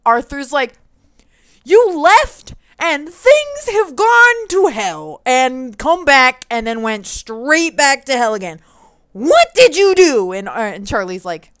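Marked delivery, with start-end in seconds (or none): 1.6-10.3 slow, loud and high furious tirade; angry scolding. Pretend. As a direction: drag each word out in fury. Arthur scolds Charlie on return.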